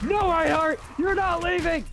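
loud high yell; shouting. Real, not acted. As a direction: yell it at the enemy. Yelling at enemy in game.

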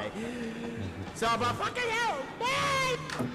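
soft high-pitched laugh; quiet helpless squeals of laughter. Real, not acted. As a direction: laugh high, almost without voice. Laughing at the game audio.

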